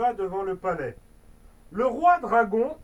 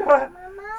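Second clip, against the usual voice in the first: a short real burst of laughter breaking the reading; quick real laugh, higher pitched; 9 semitones higher, 7 dB louder than usual. Real, not acted. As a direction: laugh out loud mid-sentence.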